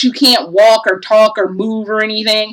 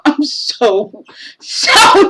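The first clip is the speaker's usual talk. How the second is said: ordinary talk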